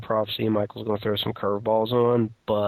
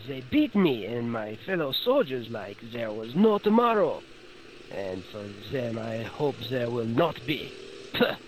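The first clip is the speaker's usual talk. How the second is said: put-on high, flustered French policeman accent; nervous French officer, stammering; French gendarme voice; 4 semitones higher than usual. Plays beaten-up French gendarme.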